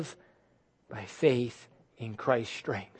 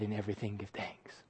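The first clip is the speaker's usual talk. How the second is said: hushed whisper; 5 semitones lower, 11 dB quieter than usual. Retold or imitated. Preacher to congregation, whispered verse. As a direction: whisper the scripture under the breath. Quoting scripture softly.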